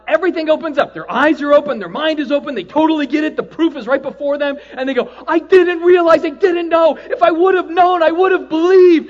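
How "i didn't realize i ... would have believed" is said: high, loud awed cry; loud, high dismay at the truth; stunned realization. Acted. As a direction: gasp out the dawning truth. Dead person seeing God.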